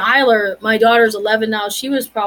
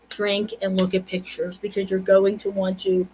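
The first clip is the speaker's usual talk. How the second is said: ordinary talk, nothing marked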